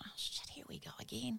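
whispered thought. Retold or imitated. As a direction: breathe it out like a private curse. Inner thought, dreading detention pickup.

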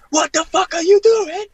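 loud, very high mock fury; hammed-up furious shout; seething outburst. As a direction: imitate a boss exploding with rage. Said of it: "seething, ... breathing fire".

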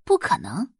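ordinary delivery

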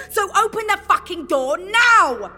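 high, forceful demand; furious order. Acted. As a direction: explode at a disobedient team. Leader losing patience.

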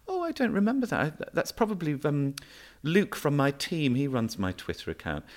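lampoon her brushing it off, high and breezy; imitating Nadine. Imitating the minister dismissing the retweet, high breezy mock voice. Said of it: "And she went".

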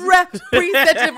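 high, slow, laughing over-articulation; over-enunciated. Retold or imitated. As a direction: spell it out to a stupid machine. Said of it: "enunciating in ways that don't make sense".

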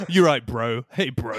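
loud bro-speak imitation; mock surfer dude, loud. Mimicking dialogue bro talk.

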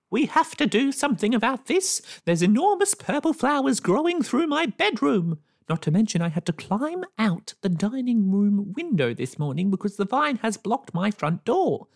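high, loud, frantic neighbour; near breakdown. A narrator's reading. Neighbour frantic to the lane about the vine, panicky high voice. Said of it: "close to a nervous breakdown".

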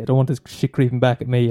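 ordinary delivery

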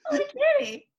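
high, loud gushing over something cute; gushing delight. Real, not acted. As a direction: squeal it with delight. Gushing over the double act's name.

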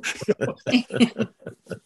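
short amused laugh; real light laugh. Real, not acted. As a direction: laugh off the family joke. Laughs after family joke.

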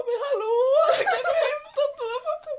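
high, laughing mock plea; pleading brother. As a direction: beg for toilet paper in a squeaky imitation. Imitates brother calling for paper.

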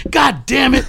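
high, loud grumpy yell; angry outburst. Retold or imitated. Branford woken by the messages, high loud curse. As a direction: woken man cursing from the couch.

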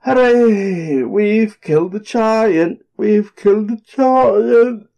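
high, slow weary cheer; exhausted cheering. A narrator's reading. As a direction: cheer though worn out.

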